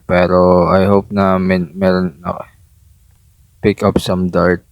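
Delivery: normal talking voice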